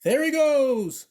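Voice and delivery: a high, loud, slow shout. A narrator's reading. Vice principal spotting the dog running, high loud shout. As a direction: shout it out pointing after him. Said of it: "shouted Vice Principal Tanner".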